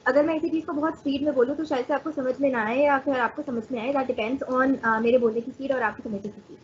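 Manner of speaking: rattled off at speed; very fast. Shown as a demonstration. Teacher showing students rapid speech, very fast. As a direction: rush through it with barely a break. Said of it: "the first one was very fast".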